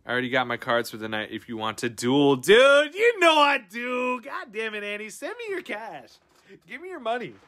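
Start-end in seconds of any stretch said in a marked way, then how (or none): 2.4-4.2 high, drawn-out playful shout; hyped mock outrage. Streamer answering viewer's duel offer, hammed-up shout. 4.2-7.3 high, softer mock exasperation; playful pleading demand. Streamer begging Annie for cash, playful high demand.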